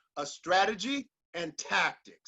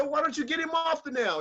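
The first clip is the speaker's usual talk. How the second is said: higher-pitched, mildly exasperated question; raised pitch, puzzled appeal; baffled passerby; 7 semitones higher, faster than usual. Acted. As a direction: act out a bothered passerby questioning the owner. Passerby questions the dog's owner.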